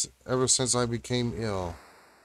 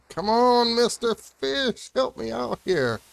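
much higher, pleading sing-song to the fish; high-pitched coaxing; 10 semitones higher, slower than usual. Acted. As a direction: sweet-talk the fish in a high voice.

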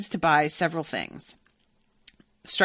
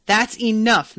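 louder, higher, sharp command; barked order; 5 semitones higher, 9 dB louder, slower than usual. Acted. Shopkeeper cuts off the chatting, barking. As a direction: bark it to cut them off. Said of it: "Mister Piper barked".

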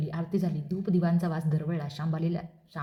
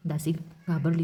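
ordinary delivery, nothing marked

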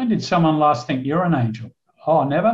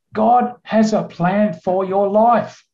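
"God has a plan for your life" slow, higher and weighty; retold emphatic declaration; 6 semitones higher, 3 dB louder, slower than usual. Rescuer solemnly exhorting the survivor.

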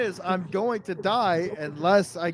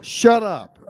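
exasperated silencing; 4 semitones higher, 5 dB louder, slower than usual. Real, not acted. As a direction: silence a friend firmly and loudly. Silencing guest who keeps talking over him.

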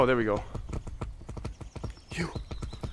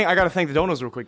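ordinary delivery

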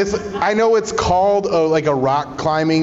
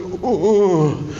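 airy playful ooh sounds; mock pleasure moan; 3 semitones higher than usual. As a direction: moan it jokingly through a laugh. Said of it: "you're just like".

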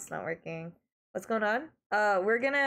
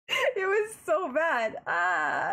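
higher and slow, dragged-out despair; mock-anguished groan; 8 semitones higher, 4 dB louder, much slower than usual. Streamer to chat, reliving the cringe, dragged-out mock anguish. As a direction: stretch it like a wounded wail. Repeats the line, now drawn out.

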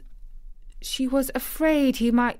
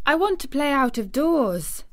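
put-on higher, careful girl's voice; a touch of nerves held in check; nervous resolve; 6 semitones higher, 3 dB louder than usual. A narrator's reading. Girl answering her guardian, trying not to tremble. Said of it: "hoping that her voice did not tremble".

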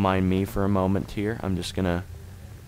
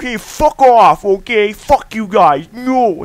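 high, loud indignant outburst; mock a touchy guy blowing up, voice pitched way up; hammed-up high-pitched tantrum; imitating angry schoolmate; 11 semitones higher, 10 dB louder, slower than usual. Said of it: "he talks like that, like, seriously".

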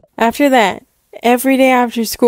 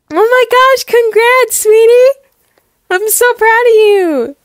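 high-pitched gushing congratulations; overjoyed mom; 11 semitones higher, 4 dB louder than usual. Acted. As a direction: squeal with pride at your daughter. Mother rejoices at news.

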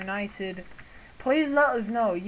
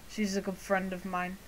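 normal talking voice

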